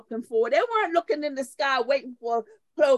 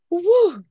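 relieved woo; 9 semitones higher, 8 dB louder than usual. Retold or imitated. Girl released after deliverance.

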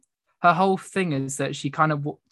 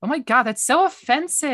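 higher-pitched mock outrage; offended retort; 10 semitones higher than usual. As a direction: play up the offended gasp-and-complain tone. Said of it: "mimicked her. And she was like".

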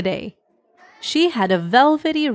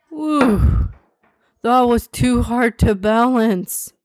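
relieved whew, lightly voiced schoolboy; a hint of a kid exclaiming with relief; boy exclaiming; 3 semitones higher, slower than usual. A narrator's reading. Carl after almost dropping lemonade bottles, lightly exclaiming in relief. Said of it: "Carl exclaimed".

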